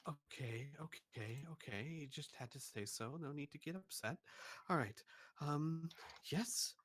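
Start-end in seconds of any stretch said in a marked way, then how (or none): 0.1-4.2 much softer, placating mutter; soft grumble. Acted. Shopkeeper calming the upset customer in a soft mutter. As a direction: back off and soothe under your breath.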